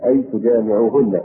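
usual speaking voice

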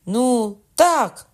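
high, loud impatient cry; exclaiming. A narrator's reading. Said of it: "скрикнув Кокона".

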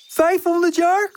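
very high little voice; child character asking. A narrator's reading. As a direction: guess in wide-eyed, high pitch. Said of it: "vraagt Molletje". (hoog, kinderlijk vragend stemmetje)

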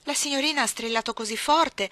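the maid tries to justify herself; apologetic maid. Acted. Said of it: "cercò di scusarsi Bessie". (Bessie si scusa con la padrona)

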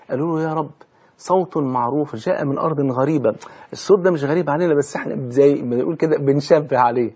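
ordinary delivery

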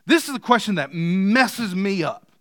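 ordinary delivery

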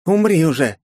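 high, loud burst of rage; furious cry. A narrator's reading. Margaret throws another grenade, furious high cry. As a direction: hurl it at an enemy who will not die.

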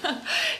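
short unvoiced mocking laugh; breathy scornful laugh. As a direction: let out a quick airy laugh at them. Lilli laughs at the family.

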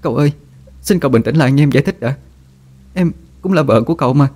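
high, sobbing plea; tearful pleading. A narrator's reading. Tearful wife pleads for him to calm down. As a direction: beg through tears. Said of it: "thúc thích van xin".

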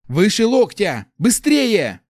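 loud, high, frantic command; urgent shouted order. A narrator's reading. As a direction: shout to hurry them on. Urgent shouted orders during amputation. (срочный приказ криком)

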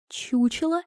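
high, restrained, disbelieving question; high incredulous echo; stunned echo. Acted. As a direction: repeat it quietly in disbelief. Princess stunned by the insult.

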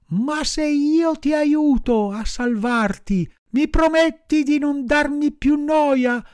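put-on a wooden boy striking a deal; high puppet voice, half wary; puppet boy bargaining. A narrator's reading. Pinocchio bargains with the dog.

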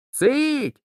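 high, sudden hush; boy hushing a dog. A narrator's reading. Peter silences the barking dog, high-pitched boyish command.

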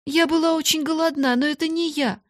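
high-pitched plaintive excuse; sobbing plea. A narrator's reading. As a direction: plead tearfully with the mistress. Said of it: "рыдая, оправдывалась Бекки".

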